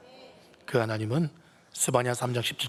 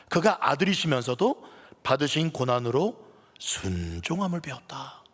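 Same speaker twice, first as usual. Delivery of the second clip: ordinary talk, nothing marked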